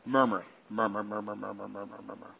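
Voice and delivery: low, fading mumble; soft, low, repetitive muttering; murmuring. Shown as a demonstration. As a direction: murmur it under your breath. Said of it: "that sounds like what it is".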